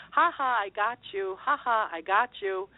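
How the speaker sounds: singsong mock "ha ha" of a gleeful boy; laughing little boy. Little boy poking her arm as he gloats, lightly mimicked teasing. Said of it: "I heard this little boy laughing and poking me".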